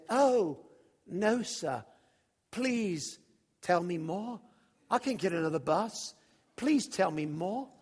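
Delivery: pleading waif in a put-on Dickensian accent. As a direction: beg politely in a Dickens-play accent. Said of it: "I felt like I was in the Charles Dickens play".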